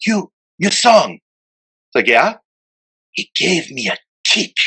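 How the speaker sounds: imitating Roland. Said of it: "he goes".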